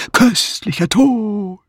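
slow, drawn-out mock ghostly wail; sepulchral voice. A narrator's reading. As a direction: intone it eerily, stretching the vowels. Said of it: "rief Patrick mit Grabesstimme". (langgezogene Grabesstimme)

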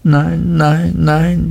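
high, distressed no; understated crying out; mild cry of protest. A narrator's reading. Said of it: "schrie die kleine Emily".

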